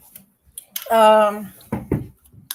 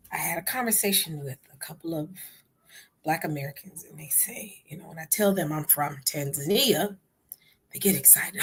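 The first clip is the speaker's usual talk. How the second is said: ordinary talk, nothing marked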